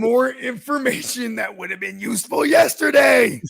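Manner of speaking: hammed-up loud, high yell; mock movie shout. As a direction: belt the line like the movie character. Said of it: "I was quoting that movie".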